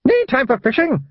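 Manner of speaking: high broad Scots voice; a wee pixie barking in Scots; tiny Scottish man. A narrator's reading. First wee man hauling his friend aboard, high broad Scots. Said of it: "said the first man".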